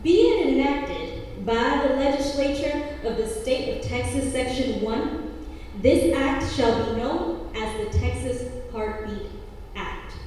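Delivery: loud, stiff bill recitation; formal legal reading. Acted. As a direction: read it like a statute. Reads bill text in poem.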